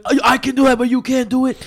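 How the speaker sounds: high, loud taunting coach; mocking coach. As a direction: boast loudly like the coach.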